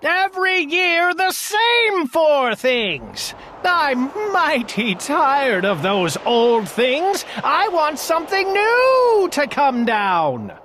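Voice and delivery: high, slow, petulant royal rant; lightly hammed-up furious old king. A narrator's reading. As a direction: demand it like a spoiled old king. King ranting at Bartholomew.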